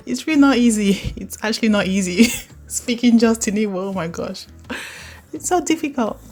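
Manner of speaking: laughing in frustration; giggly exasperated admission. Real, not acted. Laughing at own struggle.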